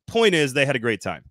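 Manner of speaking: ordinary talk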